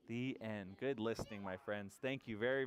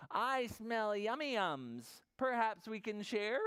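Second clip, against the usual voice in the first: higher, eager badger voice; grinning badger; 8 semitones higher, slower than usual. Badger asking to share the snack, high and grinning. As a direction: offer it as a friendly storybook badger. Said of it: "Badger says with a grin".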